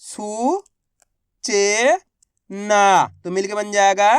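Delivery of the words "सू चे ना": each syllable spelled out separately with pauses; spelling-out voice for children. Shown as a demonstration. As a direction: break सू चे ना into slow separate beats. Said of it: "अब आगे देखिए".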